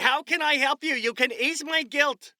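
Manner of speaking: high, loud mock guilt-ridden plea; guilty do-gooder. Mocking successful people to listener. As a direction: ham up a guilty rich person begging to help.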